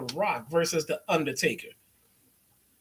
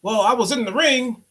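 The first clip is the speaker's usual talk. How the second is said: higher-pitched, eager rambling alibi; mock wrestler explaining to cops; 7 semitones higher than usual. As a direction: babble the excuse like a guilty kid. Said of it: "you gotta now tell the cops what happened".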